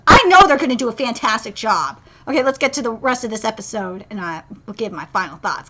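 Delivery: usual speaking voice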